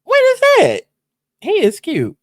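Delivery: high-pitched giddy delight; excited gasp. Retold or imitated. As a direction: squeal over a cute guy. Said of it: "I was like".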